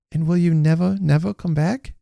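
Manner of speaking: put-on pleading child. A narrator's reading. Child asking the departing man, pleading. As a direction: plead in a small child's voice, rising on never.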